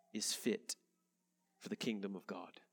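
ordinary delivery

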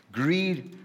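usual speaking voice

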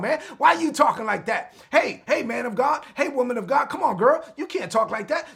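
rally them like an urgent encourager; fired-up, insistent cheering-on; rousing exhortation. Acted. Acted friend rousing a discouraged believer.

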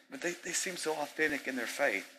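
high, soft whiny objector; mock pleading objector. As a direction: mimic a naive believer. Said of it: "I had somebody tell me".

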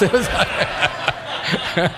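breaking into laughter mid-sentence; real laughter; laughing. Real, not acted. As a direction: laugh through the words.

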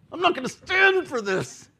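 high-pitched indignant outburst; indignation. Retold or imitated. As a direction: retell it with mock outrage, pitch way up.

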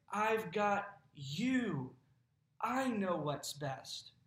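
slow, calm reassurance; reassuring. Retold or imitated. God reassuring the anxious believer, slow calm reassurance. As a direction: hold them safely, slowly.

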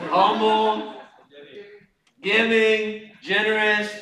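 high, loud, held-out single words; calling out answers. Real, not acted. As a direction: toss each answer back to the crowd. Calls out audience answers loudly.

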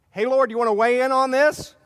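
loud, brash call upward; calling out to God. Retold or imitated. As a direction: challenge God out loud, cheeky. Adam and Eve challenge God.

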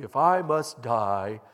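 loud, slow emphatic vow. Acted. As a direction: hammer each word, slow and loud. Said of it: "said emphatically".

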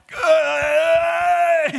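loud, high drawn-out straining cry; strained grunt. Retold or imitated. Exhausted lifter stuck under the bar, straining yell. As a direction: groan at full effort under the bar. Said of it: "you're just like".

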